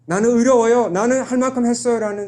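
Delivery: a son protesting he did enough; indignant elder son. Retold or imitated. Repeated self-righteous claim, louder.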